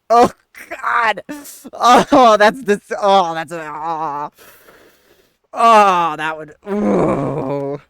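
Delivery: loud shocked gasping; shocked outburst. Real, not acted. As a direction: cry out in shock at the burn. Reacting to the insult.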